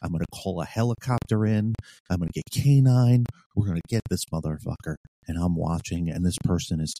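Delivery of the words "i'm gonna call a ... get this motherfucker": ordinary talk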